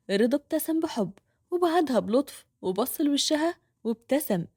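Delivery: plain reading voice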